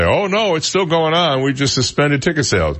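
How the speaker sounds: high, breezy spokesman brush-off; sing-song corporate "all is fine"; mock official reassurance. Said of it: "other than to say".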